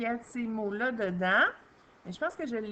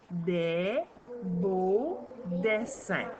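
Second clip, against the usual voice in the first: title read slowly, word by word; much slower than usual. Shown as a demonstration. Teacher reading the story title slowly to a pupil. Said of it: "par syllabe".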